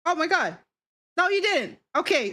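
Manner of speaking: ordinary delivery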